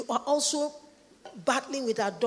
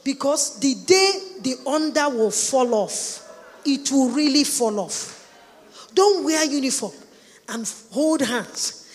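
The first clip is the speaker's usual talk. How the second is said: loud, raised sermon warning; preaching at full force; 3 semitones higher, 9 dB louder than usual. Real, not acted. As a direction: drive it home to the congregation. Preacher warns the congregation loudly.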